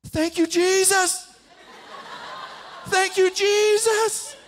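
high, loud cry of thanks; hammed-up weeping thanksgiving; imitated tearful praise. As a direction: mimic a tearful kid crying out to heaven. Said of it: "tears rolling down his face".

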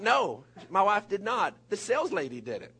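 ordinary delivery

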